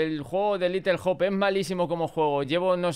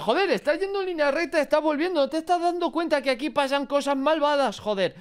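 exasperated outburst; 10 semitones higher than usual. Retold or imitated. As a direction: yell at the character for not noticing. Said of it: "Y es como".

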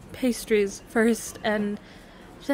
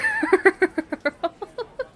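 loud giggle, pitched way up; high squealing laugh; 13 semitones higher, 7 dB louder than usual. Real, not acted. Squealing laugh at her own cheesy line to her partner. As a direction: dissolve into giggles.

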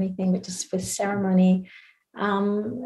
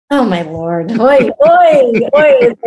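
loud, high, amused wailing; comic dismay; 4 semitones higher, 12 dB louder, slower than usual. Real, not acted. Winding down after the drunk-dad story, comically dismayed. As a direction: cry it out in playful exasperation.

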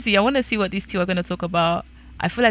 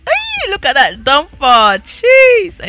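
high, loud drawn-out hollering; excited squeal; 7 semitones higher, 8 dB louder, slower than usual. Real, not acted. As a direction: hoot and squeal in admiration. Squealing reaction to a character's figure.

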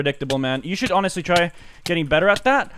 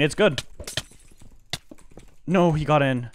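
ordinary talk, nothing marked

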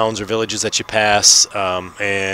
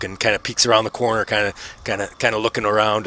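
ordinary delivery

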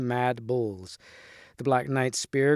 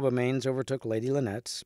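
plain reading voice

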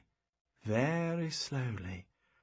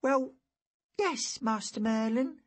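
put-on high boy's voice owning up; sheepish boy; 10 semitones higher than usual. A narrator's reading. Boy confesses curiosity to Master Merlin, sheepish. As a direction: admit it as a caught-out lad.

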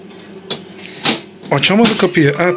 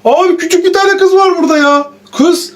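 surprised character voice; 8 semitones higher than usual. Pretend. Pretend visitor spots the girl.

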